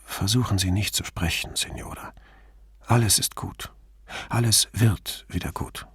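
hushed soothing whisper; calm her in a whisper. Acted. Soothing injured woman at bedside.

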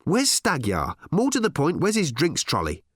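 high, insistent questioning; testy and demanding; snappy challenge. Acted. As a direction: press him impatiently, pitch climbing. Ford keeps snapping at new boss.

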